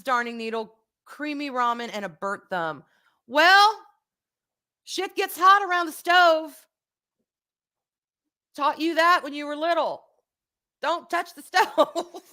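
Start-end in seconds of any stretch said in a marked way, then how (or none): 3.3-10.0 teasing. Real, not acted. Teasing son about burnt thumb. 10.8-12.2 slow, high, laughing through the warning; laughing mock warning. Real, not acted. Mother teasing her son about his burnt thumb, laughing.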